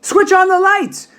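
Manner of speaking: Mister Wonka shouting to the rowers. A narrator's reading. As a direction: bellow the order to the rowers. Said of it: "shouted Mister Wonka".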